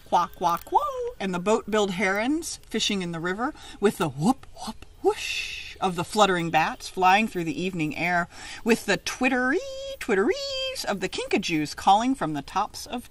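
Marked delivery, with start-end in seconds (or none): none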